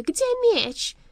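very high, loud panicked question; alarmed demand. A narrator's reading. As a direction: cry out in sudden dread. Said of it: "задрожав от ужасного предчувствия".

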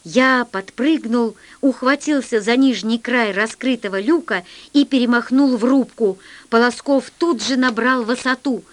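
ordinary delivery, nothing marked

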